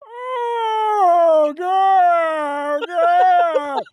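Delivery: put-on high, strained cry of an old man in pain; imitating a patient in pain. Retold hospital patient crying out to God in pain. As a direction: wail it like a patient begging for relief. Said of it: "an old man screaming because he's in pain".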